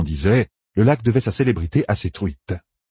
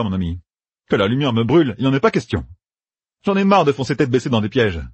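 Matt determined; 7 semitones higher than usual. A narrator's reading. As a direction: declare it with growing resolve. Narrator voices Matt.

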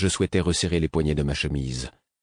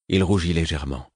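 ordinary delivery

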